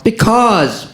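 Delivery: high, clipped mock yell. Poet winning the argument, high mock yell. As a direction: bark one word up high. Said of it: "I yell".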